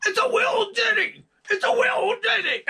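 high, loud falsetto Scottish impression, "little ditty" squeezed to "wee-l dinny"; impression of a Scottish singer. Mimicking [name] mimicking the Outfield singer, shrill Scottish falsetto. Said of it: "I'm impersonating [name], impersonating the singer from the Outfield".

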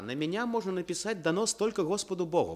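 normal talking voice

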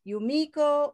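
Yumiko with a big, high middle syllable; stress on middle syllable. Shown as a demonstration. Teacher offering name choices, Yumiko stressed on the middle syllable. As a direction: punch the MI in Yumiko. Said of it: "we have to pick one syllable".

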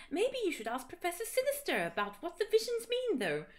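high voice. Acted. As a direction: read it up high, sweetly. Hermione voiced in fanfic.

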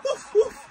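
high playful woof barks; yappy mock barking in the middle of a name; dog bark. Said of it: "It's in his name".

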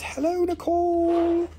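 high, slow put-on voice for the greeting; lightly put-on high greeting; reading a comment in a voice. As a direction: drawl the hello like a sweet character. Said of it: "Stars Forever says".

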